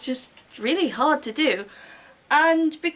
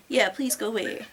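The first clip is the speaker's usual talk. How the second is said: ordinary talk, nothing marked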